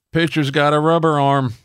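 high-pitched ballpark holler, retold; imitated heckler yell. Fan aisles up heckling the pitcher, imitated yell. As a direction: call it out high to the field. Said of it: "four aisles up yells".

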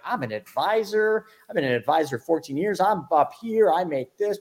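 high-pitched, self-important retold objection; egotistical protest. Advisor told to watch a video first, wounded-ego protest. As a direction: puff yourself up in mock indignation. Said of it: "My ego was all over it".

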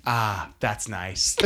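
slow, pleased "Ah, that's nice"; slow, pleased sigh. Retold or imitated. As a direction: drag it out, savoring the music. Son savoring [name], quoted.

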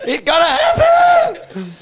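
high, slow strained shout. Retold or imitated. Desperate wife at the blocked toilets, a high slow shout. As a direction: stretch it out in a high desperate yell.

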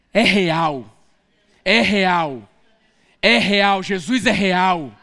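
slowly growing proclamation; building declaration. Real, not acted. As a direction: hammer the truth home.

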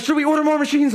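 high-pitched frantic question; mock-panicked asking for orders; anxious urgency. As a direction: imitate a jittery crew member. Anxious team asks, acted out.

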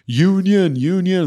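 half-shouting like a rallying workman; retold yelling. Crew rallying union workers toward the site, calling out loud. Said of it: "they all started yelling".

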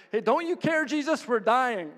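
high-pitched imitation of frantic complaining; mock panicked plea. Disciples wake Jesus as boat sinks, high mock panic.

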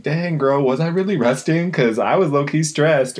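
high, excited inner voice; excited self-talk. Retold or imitated. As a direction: squeal it to yourself, amazed. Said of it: "I'm like".

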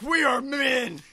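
slow, high macho bellow; macho shout. Retold or imitated. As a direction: bellow it like a strongman. Retold macho flexing.

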